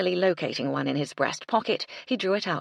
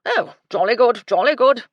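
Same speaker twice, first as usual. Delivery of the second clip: loud, pitched-up jolly good; relieved blustering old soldier; hearty old colonel; 7 semitones higher, 12 dB louder than usual. A narrator's reading. Colonel relieved after Cecily reassures him, blustering.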